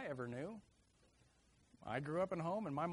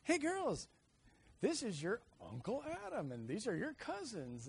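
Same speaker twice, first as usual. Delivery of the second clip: friendly uncle; 9 semitones higher, 4 dB louder than usual. Retold or imitated. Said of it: "my brother Josh goes".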